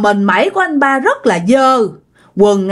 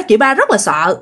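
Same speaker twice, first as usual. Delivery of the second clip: usual speaking voice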